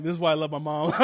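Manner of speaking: loud laughter over the line; laughing. Real, not acted. Pastor delighting in his mom's joke with loud laughter.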